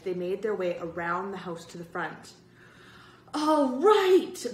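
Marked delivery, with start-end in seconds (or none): 3.3-4.5 loud, high cheer; happy cry. A narrator's reading. Boy reaching the street after escaping, loud high happy cry. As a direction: cheer it out, pitched right up. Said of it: "Bird cried happily".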